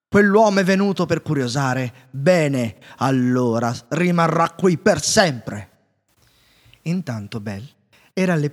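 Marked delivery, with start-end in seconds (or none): 0.1-5.7 loud, roaring, slowing at the end; the beast pronouncing his sentence in rage; furious beast. A narrator's reading. Beast raging at the intruding inventor, loud and slow. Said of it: "si infuriò".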